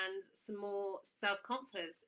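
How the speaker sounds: ordinary delivery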